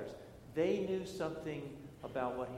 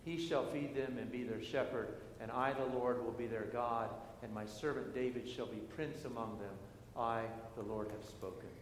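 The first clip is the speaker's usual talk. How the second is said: scripture reading; 5 semitones lower, 5 dB quieter than usual. Acted. A pastor reading Ezekiel to the congregation, measured and solemn. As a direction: read the prophecy with weight and pauses. Reads scripture lesson aloud.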